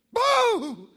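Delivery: a sharp, loud, high jump-scare yell; sudden boo. Retold or imitated. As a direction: shout it to scare the goat. Friend scaring goat.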